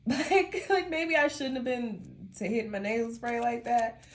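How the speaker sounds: sheepish, high-pitched confession breaking out of a laugh; amused admission. Real, not acted. Reader admitting to viewers she overdid nasal spray, laughing, high.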